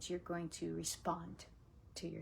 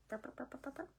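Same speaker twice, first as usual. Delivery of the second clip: quick soft burp noises; little playful burp sounds; imitated burps; 5 semitones higher, 9 dB quieter, much faster than usual. Imitating organs burping.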